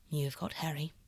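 soft, whispered resignation; sadly. Acted. Basil resigned about Harry, soft and sad. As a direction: let it fall away, resigned. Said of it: "said the painter, sadly".

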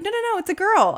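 high, loud hurried correction; frantic correction. Retold or imitated. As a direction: backpedal fast and eagerly. Husband correcting himself.

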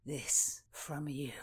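low, slow, breath nearly gone; mortally wounded. A narrator's reading. Rutland slumps at Constance's advice, mortally wounded. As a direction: utter it as if fatally hurt. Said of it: "in the voice of one mortally wounded".

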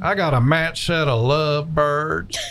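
slow, lightly voiced prank caller; a hint of a country prank-call DJ; Roy D. Mercer impression. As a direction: drawl it out like an old radio prankster. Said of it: "Now I can do him".

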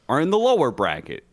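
ordinary delivery

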